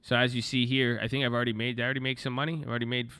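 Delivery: normal talking voice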